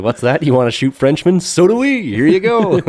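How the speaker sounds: eager Dutch trader. Retold or imitated. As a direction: play the Dutch as delighted to help. Eager Dutch arms dealers voiced.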